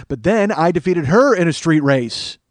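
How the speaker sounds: loud, slow, emphatic declaration; triumphant boast. Real, not acted. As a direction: proclaim the comeback victory. Boasting of winning the rematch.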